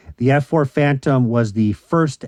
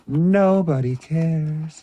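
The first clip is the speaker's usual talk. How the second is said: slow, higher, drawn-out lament; 4 semitones higher, much slower than usual. Acted. Lamenting that nobody wants Toy Biz mail-aways, slow and drawn out. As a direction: stretch it out slowly, mock sad. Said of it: "repeated phrase".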